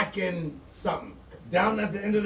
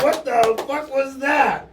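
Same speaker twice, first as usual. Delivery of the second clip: slow, louder shocked cry; stunned loud outburst; 5 semitones higher, 4 dB louder, slower than usual. Retold or imitated. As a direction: drag it out, still reeling from the kick. Repeats his stunned reaction.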